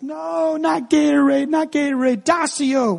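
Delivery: high whiny mock child protest; frustrated little boy. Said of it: "And he's like".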